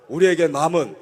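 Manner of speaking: normal talking voice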